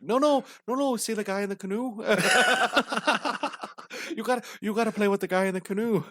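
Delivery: high-pitched mock woman's voice; women's voice. Women coaching clueless men on where to look, imitated in high voice. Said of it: "dude, the women were like".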